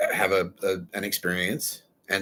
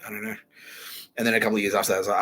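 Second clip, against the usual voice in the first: normal talking voice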